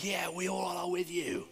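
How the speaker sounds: imitated group agreement, soft and drawn-out; slow, hushed mock chorus of support; crowd chiming in. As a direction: play a whole room agreeing, slowly. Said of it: "everyone else together is like".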